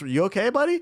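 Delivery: high, rising check-in, mildly patronising; mock concern. Streamer checking on a clueless dorm-mate, mock concern. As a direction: ask it with pitch climbing sharply on "buddy".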